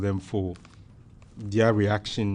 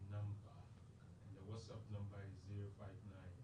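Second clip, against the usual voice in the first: very faint, far from the mic; faint, almost inaudible voice; barely audible; 26 dB quieter than usual. Real, not acted. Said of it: "your voice is, is very faint too".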